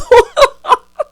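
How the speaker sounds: loud high-pitched cackle; real big cackle. Real, not acted. Laughing at own bankhead bounce joke, loud cackle.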